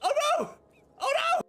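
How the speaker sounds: startled panic; alarmed. Real, not acted.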